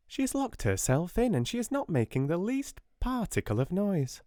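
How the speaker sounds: high, flustered spinster voice; timid sister reporting, high and fluttery; fussy Miss Amelia. Acted. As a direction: sound a bit alarmed and fussy.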